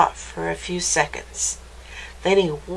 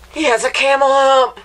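higher, louder astonished exclamation; amazed; 4 semitones higher, 5 dB louder, faster than usual. Acted. Reader to listener seeing a camel hump, amazed. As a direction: blurt it out quickly in amazement.

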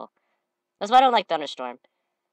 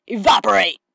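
evaporate shout; 3 semitones higher, 8 dB louder than usual. Retold or imitated. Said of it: "I'm like".

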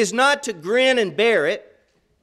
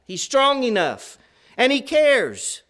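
ordinary talk

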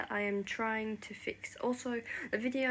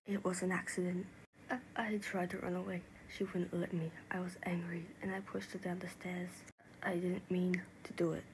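low, hushed, remorseful telling; subdued and guilt-laden; guilty confession; 3 semitones lower, 5 dB quieter than usual. Acted. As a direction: confess softly, almost under the breath. Guilty account of the death.

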